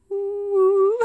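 high, loud imitated wind whoo through a chimney; wind noise. Asking co-host about chimney, imitating wind. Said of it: "where the wind goes".

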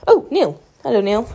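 loud greeting across the room; calling out. Real, not acted. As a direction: call out a hello.

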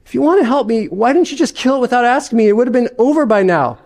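a ghost scrambling for excuses; flustered ghost. Acted.

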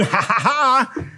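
mock scoffing ha ha ha; a light imitation of scornful laughter. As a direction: laugh it off like a skeptic. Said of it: "In the resurrection".

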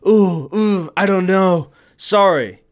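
mock-nervous clerk fumbling an apology; lightly voiced squirming employee; flustered stammer. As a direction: hem and haw, then blurt sorry.

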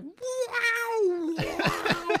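high squealing wow; mock amazed squeal. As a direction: squeal like a stunned kid. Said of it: "it's like".

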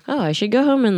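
ordinary delivery

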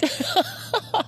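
high breathy giggle. Real, not acted. Laughs again, then "sorry, guys.

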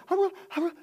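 high, loud, panicky stammer; flustered son. Retold or imitated. Son caught out by father, high panicky stammer. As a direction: scramble for an answer like a scared kid. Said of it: "And he said".

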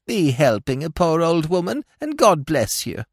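put-on old woman voice. A narrator's reading. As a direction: keep the frail beggar act going.

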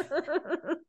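high bright laugh; burst of laughter. Real, not acted. Laughing with her cohost at the joke. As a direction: laugh out loud at the bit.

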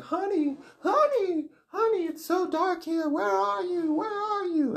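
very high, ghostly wail; dead mom's voice. Acted. Dead mom calls her child from the dark, wailing high. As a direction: call out like a lost ghost mother. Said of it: "the dead mom's voice is like".

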